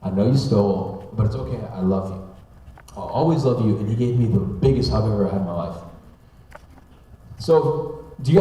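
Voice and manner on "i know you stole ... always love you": tender forgiveness. Retold or imitated. Dad to son who did something bad, tender forgiveness. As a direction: imitate a dad gently forgiving his child.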